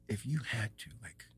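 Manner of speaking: whispered grim hypothetical; whispering. Retold or imitated. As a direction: hush it like a dare in the dark. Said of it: "we're still super quiet".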